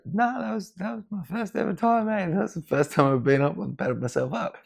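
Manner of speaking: high-pitched, put-on voice insisting it was his first time; mock high protest. Surfer insisting to the older guy it was his first time, high mock voice. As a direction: squeak out the denial, half-joking.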